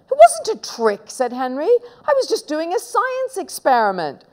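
high-pitched, indignant denial; protesting innocence. Acted. Boy defending himself to mum, high protest.

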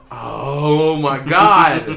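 slow laughing oh my God; laughing disbelief. Real, not acted. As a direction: drag it out through laughter. Shark attacks.

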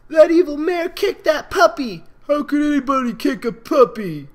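high, loud scolding foal; filly shouting at the mare who kicked the puppy; angry accusing filly. Acted. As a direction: yell the accusation like an upset child. Foals accusing Fluttershy.

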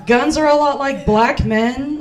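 ordinary talk

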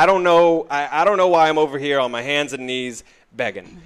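fast, worked-up rant; frustrated. Acted. Track owner venting to a mobster about asking permission, frustrated. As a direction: rattle out the grievance, exasperated. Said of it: "He clearly looks frustrated".

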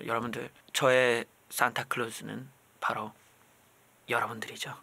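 mock tender. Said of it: "slow sweet address to viewers".

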